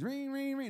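high-pitched doorbell ringing; imitated doorbell. Storyteller imitating the doorbell going off, high ringing voice. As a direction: voice the bell high, ring after ring. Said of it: "the freaking doorbell rings".

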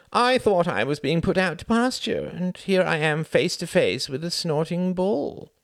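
a tired man feeling cornered; Sam, weary politician. Acted. Sam lamenting to Cuthbert over brandy, high and aggrieved.

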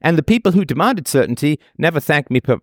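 ordinary talk

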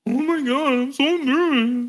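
hammed-up high girlish nerves; weird embarrassed voice. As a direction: imitate a nervous date in a squeaky pitch. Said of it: "Do it one more time".

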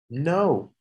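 ordinary delivery

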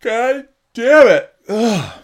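loud, drawn-out groan of frustration; frustrated outburst. Real, not acted. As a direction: curse at the annoyance, loud and slow. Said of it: "This is annoying".

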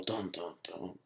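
soft, sinking dun dun dun, fading into a whisper; mock suspense sting. Mocking the snail scandal's drama, whispered sting. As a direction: hum a cheesy drama sting under your breath. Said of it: "I'm kidding".

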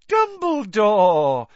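high, loud, slow gushing cry; delighted cry. A narrator's reading. Reporter crying out to Dumbledore in feigned delight. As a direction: fake delight at the headmaster. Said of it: "cried Rita Skeeter, with every appearance of delight".

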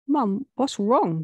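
slow, startled question to her mum; a daughter finds her mum sobbing, shocked and slow. Acted. Shocked and slow.

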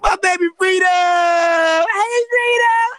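high, loud squeal of delight; excited shouting. Real, not acted. Greeting a beloved friend Freedom on arrival, excited shrieking. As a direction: shriek a thrilled welcome. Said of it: "I'm so excited!".

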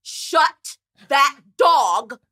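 loud, drawn-out annoyed shout at a noise; mock angry shout; annoyed yell. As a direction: yell at whoever owns the dog, stretching it out. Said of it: "hearing this howling sound".

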